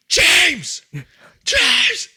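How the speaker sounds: high loud call across the yard; shouting. Retold or imitated. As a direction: yell his name sharply. Teacher shouting his name.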